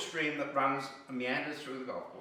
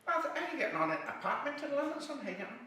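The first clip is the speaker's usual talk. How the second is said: lightly voiced high Scottish mum; high, chatty imitation of a mother; 11 semitones higher, faster than usual. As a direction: play a mum on the phone, pitched up. Scottish mum phoning her son.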